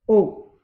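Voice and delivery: "O" called very loud with rounded lips; closed-mouth vowel O. Shown as a demonstration. Teacher drills pupils on "O", loud with closed rounded lips. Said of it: "Tu fermes vraiment la bouche".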